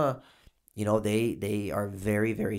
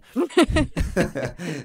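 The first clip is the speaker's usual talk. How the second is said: real laugh; 9 semitones higher, 7 dB louder than usual. Real, not acted. Laughs at own bad accent.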